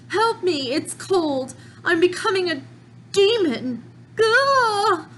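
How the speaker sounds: high, panicked cry for help; terrified victim. Acted. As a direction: plead as you turn into a demon. Infected victim crying out.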